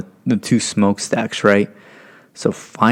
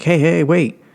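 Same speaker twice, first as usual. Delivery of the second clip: higher-pitched urgent call to wait; calling after someone; 8 semitones higher, slower than usual. Retold or imitated. New airman to departing sponsor, calling after him. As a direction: stop him before he leaves.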